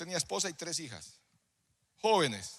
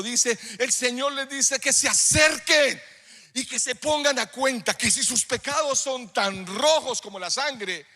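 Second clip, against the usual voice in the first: raised, slow, drawn-out proclamation; full-force preaching; 6 semitones higher, 7 dB louder, slower than usual. Real, not acted. Preacher proclaiming Isaiah's call to draw near, full-force preaching. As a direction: proclaim the prophecy, stretching the key words.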